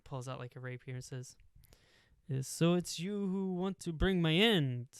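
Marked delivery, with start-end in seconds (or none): 2.3-4.9 put-on slow, higher villain with a clumsy Italian accent; bad Italian accent. Pirate lizard threatens Fenris, in a mangled Italian accent. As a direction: butcher an Italian accent on purpose, slowly. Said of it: "speaks with this, like, kind of cool Italian accent that I'm gonna butcher".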